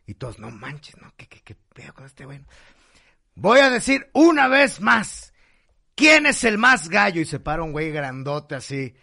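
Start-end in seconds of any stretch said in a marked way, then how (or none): 0.4-2.4 muttering. Acted. Crowd mutters again. 3.3-7.2 put-on drunk challenge.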